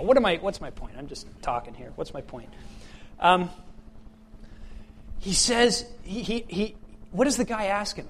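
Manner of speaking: ordinary talk, nothing marked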